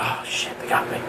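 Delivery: breathless unvoiced whisper of dread; panicked whisper. Pulled over for speeding, he whispers in dread. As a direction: whisper it like you've just been caught. Caught speeding by another cop.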